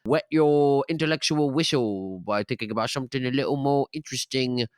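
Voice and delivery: slipping into broad Cockney; chatty host gone suddenly Cockney; Cockney accent. Pretend. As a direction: put on a playful Cockney voice. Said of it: "I started talking with a Cockney accent".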